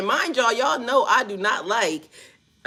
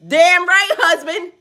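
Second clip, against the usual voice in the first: excited; 8 semitones higher, 7 dB louder, slower than usual. Real, not acted. Excited reply to her aunt.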